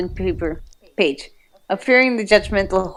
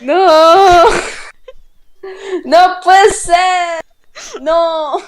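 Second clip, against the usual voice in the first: high, loud hammed-up wail; mock anguished cry; 9 semitones higher, 6 dB louder, much slower than usual. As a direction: wail the no like a melodrama villain. Mock despair over fanfic scene.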